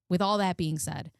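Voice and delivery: ordinary talk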